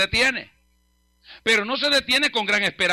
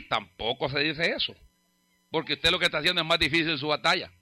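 ordinary talk

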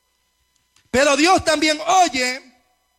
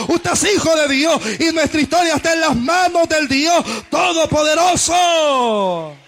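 loud, driving sermon delivery; impassioned preacher in full flow; full-force preaching; 3 semitones higher, 6 dB louder than usual. Real, not acted. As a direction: preach it hard, phrase after phrase, building to a long final cry.

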